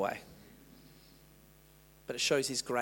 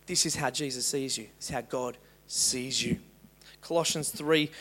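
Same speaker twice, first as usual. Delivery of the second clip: usual speaking voice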